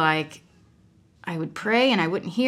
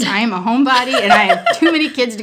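fast, louder, laughing through the words; 3 semitones higher, 6 dB louder, faster than usual. Real, not acted. Homebody mom joking to host about her many kids, laughing. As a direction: rattle it off with a self-mocking laugh. Self-mocking remark with laugh.

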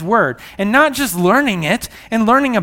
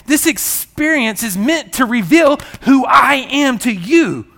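preaching shout; 7 semitones higher, 5 dB louder than usual. Real, not acted.

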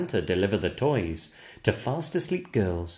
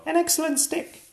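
much higher, excited dog voice; barking dog voice; 13 semitones higher, much faster than usual. Acted. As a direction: yap it out like an eager dog. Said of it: "barks the dog".

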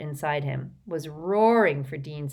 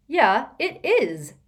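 slow, loud, high angry retort; 8 semitones higher, 6 dB louder, slower than usual. A narrator's reading. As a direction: snap back furiously, slowly. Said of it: "said Ron just as angrily".

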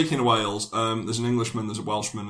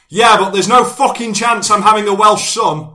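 high, loud yell over the shoulder; shouting back; 10 semitones higher, 12 dB louder than usual. Retold or imitated. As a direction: bellow it back while running. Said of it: "And he shouts back".

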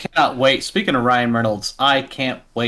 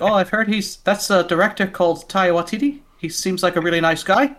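higher-pitched mock Korg accent; playful, higher Korg-accent impression; imitated Korg accent; 7 semitones higher than usual. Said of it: "everybody with these weird accents".

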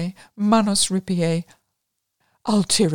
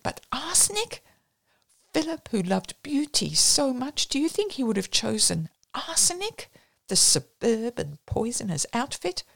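incredulous; 6 semitones higher than usual. Acted. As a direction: rise in disbelief at arsenic. Vaughan's incredulous protest.

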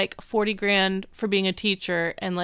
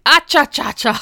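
loud, high, goofy ah cha cha cha; Eric's ah cha cha; 6 semitones higher, 9 dB louder than usual. Retold or imitated. Imitating Eric's goofy phone celebration, loud and high. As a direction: belt out a silly cha-cha whoop. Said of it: "That's what he says".

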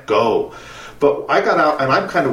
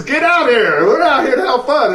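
laughing call; 10 semitones higher, 3 dB louder than usual. Retold or imitated. Examiner calls from the water.

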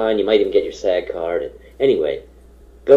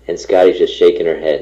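ordinary talk, nothing marked

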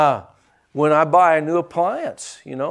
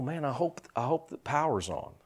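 mock worry; 11 dB quieter than usual. Preacher imagining fretting over a new appliance, mock worry. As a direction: mutter it softly as if privately hoping.

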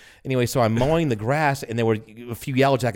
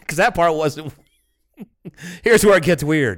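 laughing, higher and louder; 7 semitones higher, 5 dB louder than usual. Real, not acted. Laughing at his friend's "what was weird" jab. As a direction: giggles through the words. Laughing while teasing back.

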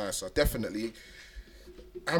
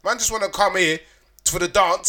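animated rant; 5 semitones higher, 4 dB louder than usual. Real, not acted. Mocking lazy holiday friends.

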